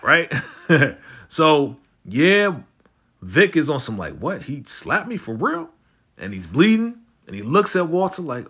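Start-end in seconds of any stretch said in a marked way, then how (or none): 4.2-5.7 high-pitched incredulous question; mock disbelief. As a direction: imitate someone stunned by a slap. Retells Vic's shock at slap.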